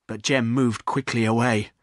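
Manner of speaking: ordinary delivery, nothing marked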